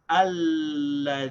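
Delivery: "alladhina" drawn out slowly, long held l and long ee; demonstrated pronunciation. Shown as a demonstration. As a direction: sound out "alladhina" slowly, syllables joined. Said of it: "This is pronounced".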